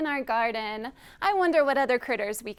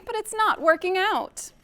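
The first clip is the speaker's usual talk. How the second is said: higher, mildly plaintive complaint; sad moan; 6 semitones higher than usual. Acted. Little bird confiding in the friendly donkey, mild sad moan. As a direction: moan it with a touch of sadness. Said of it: "moaned the little bird quite sadly".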